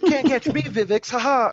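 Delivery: high, sing-song mock taunt. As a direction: tease like a cocky kid. Mocking taunting character.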